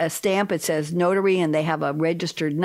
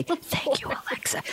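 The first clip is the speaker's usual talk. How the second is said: unvoiced whispered thanks; 8 semitones higher, 6 dB quieter than usual. Host whispering thanks to Alexa. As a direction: breathe the thanks softly. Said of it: "So I went into whisper mode".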